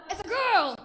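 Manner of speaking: high, very loud mock reveal; shouted announcement. Drunk guest announcing it's a girl, shouted reveal. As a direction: bellow it out triumphantly.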